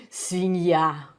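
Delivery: native Свинья with a falling end; Russian demo. Shown as a demonstration. Teacher demonstrating Свинья in native Russian. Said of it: "Again, что ты ска".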